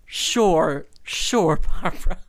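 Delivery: loud, indulgent sure, sure; Oprah impression. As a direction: humour the star, drawn out and loud. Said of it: "And Oprah is like".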